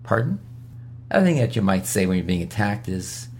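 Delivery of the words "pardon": quiet blank "Pardon?". Shown as a demonstration. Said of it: "Sit there quiet and look at them".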